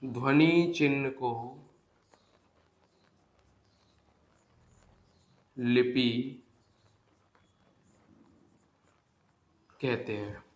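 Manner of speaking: slow, spaced dictation for notes; dictating to students. Acted. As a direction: read it out word by word for writing down. Dictating definition slowly.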